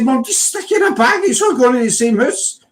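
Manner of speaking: high, pleading whine at mum; exasperated plea to mum. Retold or imitated. Son pleading with his mum over shopping bags, high whine. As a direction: beg mum to just bag it all.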